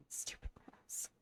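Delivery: breathy whispered jab with a laugh; laughing whisper at the mouse. Real, not acted. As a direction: hiss it under your breath, amused. Mocking the game character.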